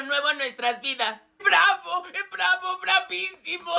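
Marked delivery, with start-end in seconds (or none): 1.4-3.7 tearful bravo. Acted. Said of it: "ya no llore".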